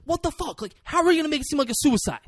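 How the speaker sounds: high, loud incredulous ranting; mock exasperated yelling. Retold furious movie character.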